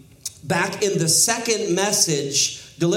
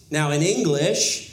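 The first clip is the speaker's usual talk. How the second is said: ordinary talk, nothing marked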